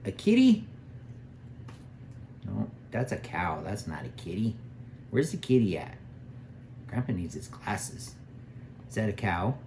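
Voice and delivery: put-on sing-song voice for a little child; reading to a toddler. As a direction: coax a toddler, big pitch swings. Grandpa with granddaughter, board book.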